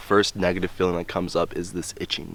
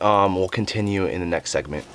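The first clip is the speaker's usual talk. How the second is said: ordinary talk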